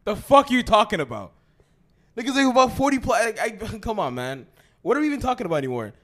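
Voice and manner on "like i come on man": usual speaking voice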